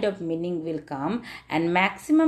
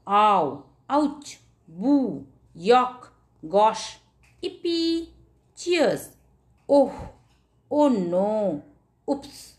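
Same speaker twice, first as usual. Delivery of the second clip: 1 acted interjections; much slower than usual. Shown as a demonstration. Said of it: "Look at here".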